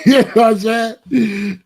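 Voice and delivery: cracking up mid-question; laughing out loud. Real, not acted.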